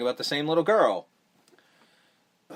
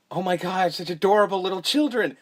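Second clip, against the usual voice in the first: put-on higher, louder gushing over the kids; gushing Roseanne; 5 semitones higher, 3 dB louder than usual. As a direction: coo like a doting old lady. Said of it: "This is Roseanne".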